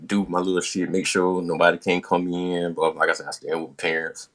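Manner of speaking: ordinary talk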